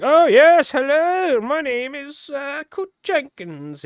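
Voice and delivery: high, hammed-up character voice, drawn out at the end; put-on roleplay voice. Shown as a demonstration. Gamer mocking over-the-top roleplayers, silly high put-on voice. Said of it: "doing a voice and role".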